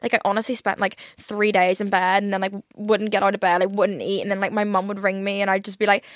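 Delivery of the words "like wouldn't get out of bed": usual speaking voice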